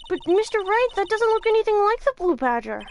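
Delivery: lightly voiced anxious sidekick; high protesting voice. A game character voiced in protest.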